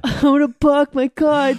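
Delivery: put-on loud East Coast accent, laughing. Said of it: "I wanna hear her full Boston accent".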